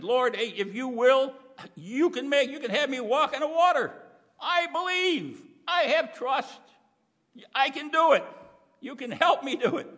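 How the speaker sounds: high, loud eager plea; eager Peter pleading. Acted. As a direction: beg like a thrilled disciple. Peter pleads to walk on water.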